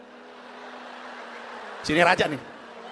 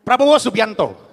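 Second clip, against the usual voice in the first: drawn-out, louder; slow grand announcement; 2 semitones higher, 8 dB louder, slower than usual. Shown as a demonstration. Comic practicing a grand intro of Prabowo, slow and louder. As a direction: announce the name like a herald. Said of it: "Gua latihan digiring bentar ah".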